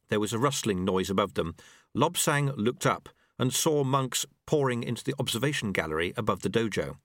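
plain reading voice

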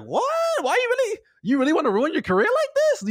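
high-pitched incredulous questioning; mock disbelief. Voicing people reacting to his ruined career, incredulous. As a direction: squeal it like a stunned onlooker. Acted disbelief of the watching crowd.

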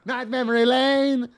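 high, squealing mock plea; hammed-up high whine of protest; pleading victim. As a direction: beg like a dragged cartoon character. Characters dragged protesting.

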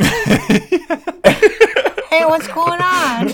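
very high squeaky pig voice, slowing at the end; voicing Babe. Babe catching the monkey at his suitcase, squeaky and high. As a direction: play the baffled little pig. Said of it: "Babe's like".

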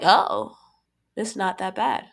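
ordinary delivery

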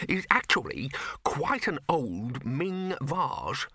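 slow, hushed whisper. A narrator's reading. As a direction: breathe it out in awe. Bursar whispers over the vase.

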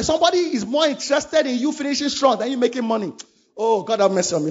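ordinary delivery

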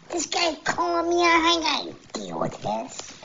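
high-pitched mock protest; Wonderboy. Fighter begs for another opponent, high-pitched mock indignation. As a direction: act out a flustered refusal in a high voice, stretching the demands. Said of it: "And Wonderboy, uh, said".

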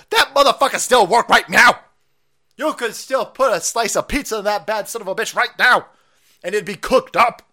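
loud, high excited rant. Real, not acted. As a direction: shout it out in mock outrage. Ranting about old American toasters.

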